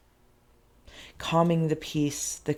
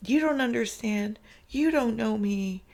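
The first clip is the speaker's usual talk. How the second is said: higher, tearful plea, near crying; close to tears; 6 semitones higher than usual. Real, not acted. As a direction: let the voice lift toward tears. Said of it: "I'm gonna cry again".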